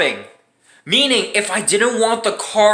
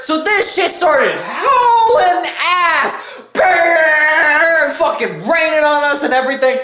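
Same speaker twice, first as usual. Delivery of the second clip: laughing yell at the storm; laughing shout; 6 semitones higher, slower than usual. Real, not acted. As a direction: hype the storm hitting them. Thunderstorm hits, laughing shouting.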